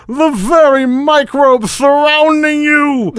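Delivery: high, drawn-out theatrical shout; exclaiming. Acted. Evil magician to the rival who resists his spell, exclaiming. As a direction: cry it out in outraged amazement. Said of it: "Trent exclaimed".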